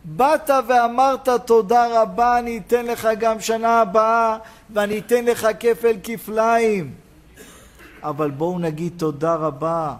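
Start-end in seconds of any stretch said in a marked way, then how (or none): 0.0-6.9 raised, ringing sermon delivery; impassioned preaching. Acted. Preacher promising blessing to the thankful, impassioned preaching. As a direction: proclaim the promise with long, loud phrase endings.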